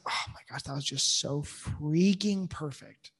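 excited whisper. Real, not acted. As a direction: hush it like a delicious secret. Gushing over her favourite scene.

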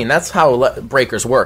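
normal talking voice

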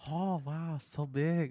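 mock impressed masseuse; imitating masseuse. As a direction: coo it like an amazed masseuse. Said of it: "Like this".

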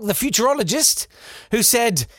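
ordinary talk, nothing marked

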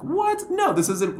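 sudden high squeak of mock outrage; playful indignant what. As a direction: jump way up in pitch, offended. Indignant retort to imagined accusation.

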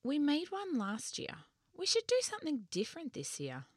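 put-on a young girl's eager voice; child voice. A narrator's reading. Child character Marley speaking.

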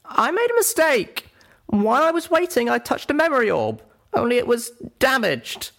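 very high-pitched character voice; Little Pip confessing. Acted. Little Pip admitting a mistake to a friend, confessing.

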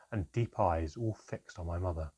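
ordinary delivery, nothing marked

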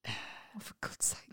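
breathy exasperated whisper. Real, not acted. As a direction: mutter it under your breath, fed up. Exasperated aside during crunches.

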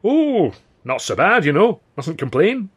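put-on cheery old pal Billy. A narrator's reading. Old pal answering Arthur's greeting, slow folksy cheer. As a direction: play the chummy old mate, unhurried.

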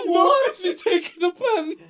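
very high, slow, squealed through laughter; loud question, losing it completely; helpless laughing disbelief. Real, not acted. Friend picking apart the absurd penalty scenario, helpless laughing disbelief.

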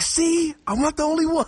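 high, triumphant mock gloat; vindicated. Speaker gloats to classmate, high triumphant tone. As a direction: crow that you were right. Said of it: "I was like".

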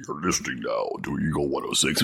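very deep, hushed station ident; mock a rumbling radio DJ; low-voiced radio DJ. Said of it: "whoever had the lowest voice won".

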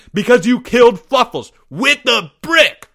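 high, loud acted scream; screamed accusation. Greg accusing Porge, high screamed accusation. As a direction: yell it out as a charge. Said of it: "screamed Greg".

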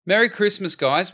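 high, loud festive cheer; excited greeting. Acted. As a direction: shout the greeting with joy. Festive show opener.

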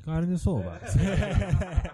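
put-on Karnisovas drawn out very slowly, high, in a hammy Italian accent. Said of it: "Do it with your Italian accent".

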